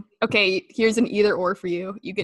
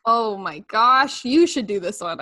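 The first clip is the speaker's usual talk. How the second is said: ordinary talk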